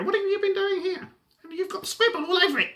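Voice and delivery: high, put-on voice of a grown-up at home complaining about scribble; mock grumpy grown-up. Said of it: "'cause I'm sure they speak like that".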